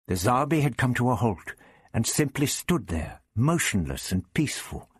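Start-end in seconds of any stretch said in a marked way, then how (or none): none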